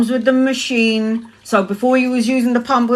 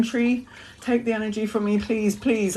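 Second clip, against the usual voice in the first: softly begging the tree, much softer; hushed plea; 7 dB quieter than usual. Retold or imitated. Stoned woman pleading softly with a garden tree. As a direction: murmur a desperate plea to the tree.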